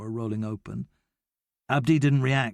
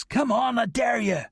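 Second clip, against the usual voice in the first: higher, taunting shout; daring shout; 11 semitones higher than usual. A narrator's reading. Noah taunting Abdi from the fence top, higher shout. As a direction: goad him, full voice. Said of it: "I shouted".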